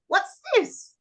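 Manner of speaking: put-on high question to a child; teacher quiz voice. Shown as a demonstration. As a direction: ask it like quizzing a small child. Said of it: "And then I'll say".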